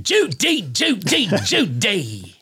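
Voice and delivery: Cary Grant impression for the ghost guest. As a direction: ham up the old movie star. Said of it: "we're talking to Cary Grant".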